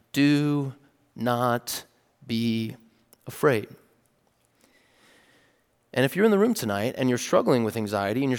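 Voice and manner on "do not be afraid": "Do" "not" "be" "afraid." each word set apart by pauses, fading softer; slow emphatic words. Shown as a demonstration. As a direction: lay the words down one by one. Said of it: "look at these last words".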